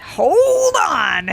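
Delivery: high, drawn-out cry of hold on, hold on drawn out high and stretched; podcast host stopping his co-hosts, high drawn-out cry; dramatic cry. Acted.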